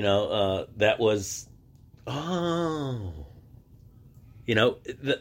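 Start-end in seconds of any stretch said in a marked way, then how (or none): 2.1-3.3 long, softer, higher "oh" sliding down; drawn-out mimicked oh of wonder; mock realization. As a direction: imitate a dawning realization. Acting classmates' reaction to his explanation.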